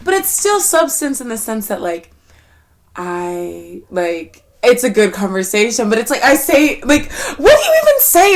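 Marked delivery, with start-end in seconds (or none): 6.1-8.3 high and loud through laughter; giggling, higher and louder; laughing. Real, not acted. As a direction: laugh the question out. Laughing at her own awkwardness.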